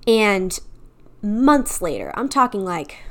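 normal talking voice

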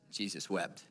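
low, soft, solemn verse; hushed solemn quote. Retold or imitated. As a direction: drop right down for a grave short verse. Reads shortest verse solemnly.

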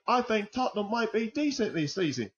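high, hammy fake English accent, mocking Tottenham optimism. Football podcaster mocking hopeful Tottenham fans, in a hammy fake English accent. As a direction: put on a bad English accent as a joke. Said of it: "in the same terrible English accent".

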